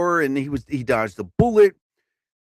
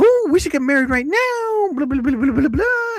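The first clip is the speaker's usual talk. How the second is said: much higher mock girly voice for the fiance; mock fiance voice, much higher, teasing; 10 semitones higher than usual. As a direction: squeal it like a pushy bride, high. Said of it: "She said".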